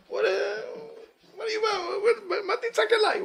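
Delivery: high-pitched mock-indignant sputter; hammed-up high, stuttering complaint; impolite God. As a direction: stammer it rudely in a squeaky, laughing voice. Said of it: "God is acting not polite".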